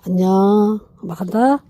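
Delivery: ordinary delivery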